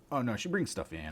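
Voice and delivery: ordinary talk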